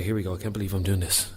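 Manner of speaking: normal talking voice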